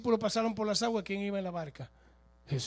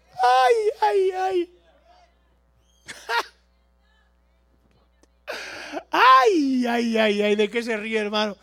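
laughing cries; 3 semitones higher, 9 dB louder, slower than usual. Real, not acted.

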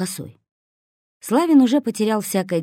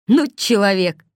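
louder, indignant outburst in a character voice; 3 semitones higher, 6 dB louder than usual. A narrator's reading. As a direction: cry out, offended by his slowness. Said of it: "возмутился Косой". (возмущённо, громче)

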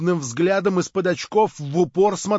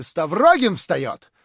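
loud outcry, climbing higher; shouted accusation; 7 semitones higher, 9 dB louder than usual. A narrator's reading. Girl student accuses Stavrogin as he rises, crying out. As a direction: point him out loudly to the room. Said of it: "крикнула студентка".

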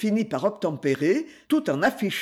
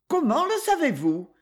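high quick probing question; 8 semitones higher than usual. A narrator's reading. Lady pouncing quickly on the wife. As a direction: pounce on her words. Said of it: "demanda rapidement lady Moley".

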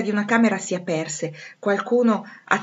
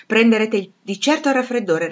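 lightly acted, raised public address; commanding master of house; 4 semitones higher, 7 dB louder than usual. A narrator's reading. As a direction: manage the guests with brisk authority. Narrator voices Rochester to the ladies. (padrone di casa, tono autorevole leggermente recitato)

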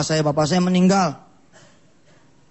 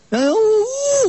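mock sobbing; much slower than usual. Acted choked reaction.

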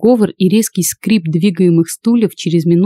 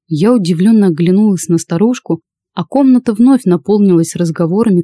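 ordinary delivery, nothing marked

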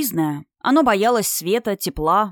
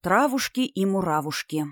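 plain reading voice

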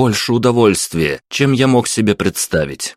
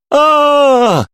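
long, high, loud scream; sustained high wail of terror; terrified scream; 5 dB louder than usual. A narrator's reading. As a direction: scream as if being cut. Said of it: "орал парень, как будто его уже начали резать".

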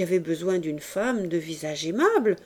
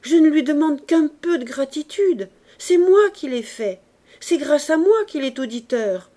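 loud plea; 6 semitones higher, 7 dB louder than usual. A narrator's reading. Widow crying out her claims.

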